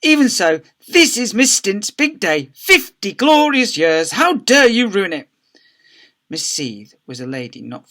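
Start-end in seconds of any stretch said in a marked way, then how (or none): 0.0-5.3 high, loud, slow outrage; indignant scolding. A narrator's reading. Secretary scolds the deputy head.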